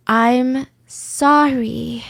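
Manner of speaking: very drawn-out, hollow sorry; rehearsed apology. Retold or imitated. Suspect repeating herself to interrogator, rehearsed and drawn out. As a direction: stretch each word as if testing it. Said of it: "overly analyzing their own cadence".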